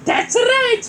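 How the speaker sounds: high, loud hero voice; Mario voice. Acted. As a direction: answer cheerfully as Mario. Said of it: "Mario said".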